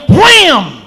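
shouted wham swooping down; explosive high crash word; imitated impact. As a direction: hit it hard and high, then fall. Imitates power knocking soldiers down.

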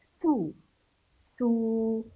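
ثو as a drawn-out thuu; tha with damma. Shown as a demonstration. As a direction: hold the u of ثو. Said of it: "هذا حرف الثاء بالضم". (نطق ثو بضم الثاء)

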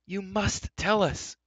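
high, slow, urgent demand; insistent, pleading outcry; urgent cry. A narrator's reading. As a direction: cry it out high, stretching the words. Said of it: "cried Terran".

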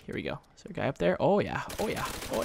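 ordinary talk, nothing marked

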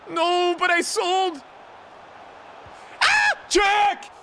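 high, loud, slow disbelieving yell; stunned gamer yell; shocked shout. Real, not acted. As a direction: cry out in shock. Shocked by a game moment.

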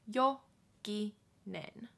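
Jokinen said very slowly, syllable by syllable. Shown as a demonstration. Teacher slowing down the name for learners. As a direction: draw out Jokinen. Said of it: "slow model".

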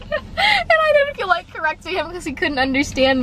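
high, laughing through the words. Real, not acted. Vlogger laughing about the misheard name. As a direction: giggle while telling it.

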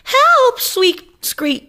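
very high loud shriek. Acted. Rosie shrieks for help as the cat grabs her, very high. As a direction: shriek for your life. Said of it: "shrieked Rosie".

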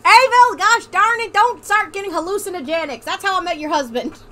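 loud, high Southern scolding, easing off; put-on Southern accent. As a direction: holler at the child like a mock Southern mother. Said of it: "This accent is really killing me".

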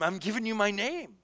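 ordinary talk, nothing marked

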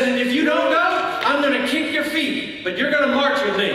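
high, insistent acted order; forceful threat. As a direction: warn him he has no choice but to march. Evangelist threatens to kick his feet.

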